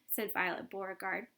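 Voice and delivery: usual speaking voice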